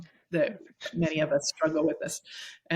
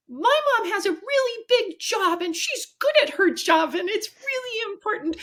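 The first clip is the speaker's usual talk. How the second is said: much higher, imitating a proud five-year-old girl; little girl's voice; 3 dB louder than usual. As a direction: pitch way up like a small child boasting. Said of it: "I hear her little voice go".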